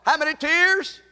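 preacher calling out to the congregation; rallying call to the crowd; call and response. Shown as a demonstration. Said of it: "I can't hear you".

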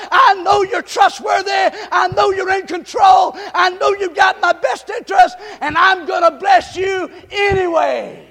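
loud, climbing sermon cadence, hammering each line; preaching at full force. Acted. Pastor rousing the church, preaching at full force. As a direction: drive it home to the congregation.

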